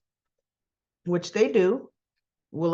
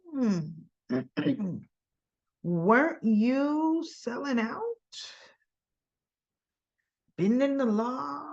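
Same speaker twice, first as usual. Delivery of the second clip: higher-pitched, drawn-out, needling questions; slow, leading questions with knowing pauses; mock cross-examination; 4 semitones higher, 3 dB quieter, slower than usual. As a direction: act out a lawyer pointedly turning the tables. Acted lawyer needling Robert with questions.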